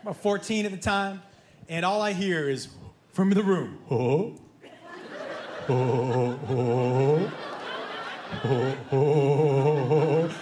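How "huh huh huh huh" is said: put-on low, dopey teenage "huh"; dim-witted, deep adolescent chuckling; teenage boy. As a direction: grunt out dumb laughs like a teenage boy. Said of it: "every teenage boy sounds like that".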